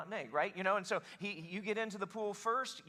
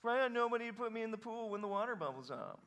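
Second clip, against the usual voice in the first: higher put-on whining voice; whiner; 4 semitones higher, faster than usual. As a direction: whine it like a helpless complainer. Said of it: "That's how he said it".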